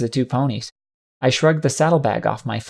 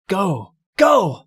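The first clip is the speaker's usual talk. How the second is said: high-pitched shout, building higher and louder; rising, urgent command; 7 semitones higher, 3 dB louder than usual. A narrator's reading. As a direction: shout them on to flee. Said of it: "Morning Dew was shouting, panting".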